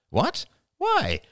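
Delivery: high, startled questioning; puzzled. Acted. Rachel questioning Ian's refusal, puzzled. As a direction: query it sharply, pitch jumping up.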